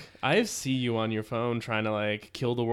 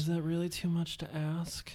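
whisper; 5 semitones higher, 7 dB quieter than usual. Acted. Joking whine after being scolded.

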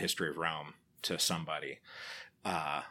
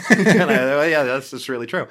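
laughing high and loud; 8 semitones higher, 15 dB louder than usual. Real, not acted. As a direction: laugh through an awkward agreement. Laughing at own retold reply.

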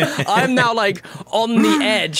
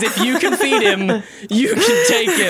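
put-on slow French accent, climbing high at the end; imitated French accent; 5 semitones higher than usual. Actor quotes co-star's dreaded stage line in a slow French accent. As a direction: mimic a Frenchman on the verge of laughing. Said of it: "crying man French accent".

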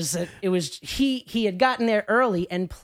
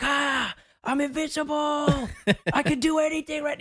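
mock-grand Tony Montana swagger, higher and slow; Tony Montana boast; 9 semitones higher than usual. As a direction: strut like a drunk gangster who thinks he's untouchable. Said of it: "like, Tony Montana".

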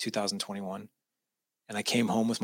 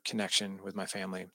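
ordinary delivery

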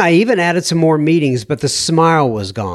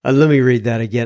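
usual speaking voice

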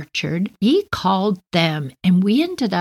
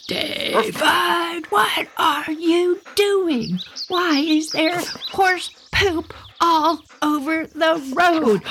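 much higher, slow, outraged mom; scolding neighbor mom; 10 semitones higher, slower than usual. Retold or imitated. Neighbor mom scolding her son over the mess. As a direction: drag it out like a shocked, scolding mother.

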